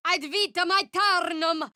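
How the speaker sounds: spell incantation. Acted. Cleric invoking her goddess in battle, fervent call. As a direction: declare it like a sacred vow.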